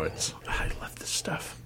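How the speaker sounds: whispered aside. Real, not acted. Tasting beer, quietly whispering about it. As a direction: whisper it fondly to yourself.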